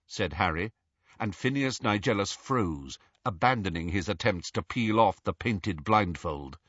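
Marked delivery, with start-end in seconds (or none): none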